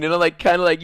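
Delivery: ordinary talk, nothing marked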